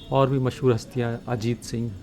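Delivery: normal talking voice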